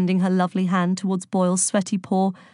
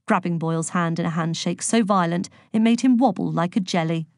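usual speaking voice

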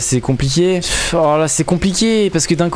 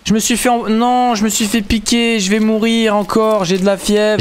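man flu; 7 semitones higher, slower than usual. Acted. Stung, claims fever.

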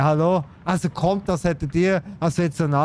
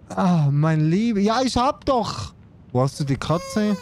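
usual speaking voice

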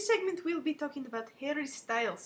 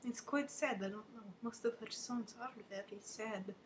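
ordinary delivery

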